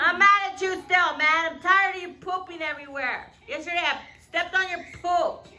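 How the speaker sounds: fake-grumpy pet scolding; mock scolding. Real, not acted.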